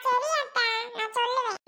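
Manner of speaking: put-on high-pitched little child voice; small child. Child promising aunt to report Subi, small child voice. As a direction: agree like an obedient tiny child.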